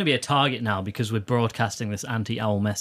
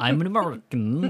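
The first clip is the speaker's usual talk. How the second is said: hammed-up American accent; 2 semitones higher than usual. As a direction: declare it as a silly fake American. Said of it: "Don't do accents again".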